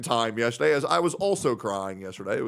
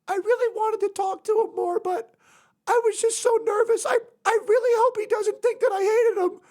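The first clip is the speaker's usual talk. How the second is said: put-on much higher girly voice, anxious and mopey; imitating Sophia sad. Streamer voicing a shy friend fretting her crush hates her, much higher. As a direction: fret like a nervous shy girl. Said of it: "she was just like".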